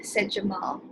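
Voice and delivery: ordinary talk, nothing marked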